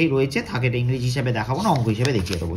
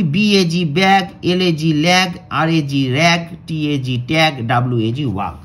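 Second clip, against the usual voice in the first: louder letter-by-letter spelling; spelling drill; 4 dB louder than usual. Shown as a demonstration. Teacher to pupils, spelling the g-words aloud. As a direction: spell out each word for the class. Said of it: "তাহলে পড়ি".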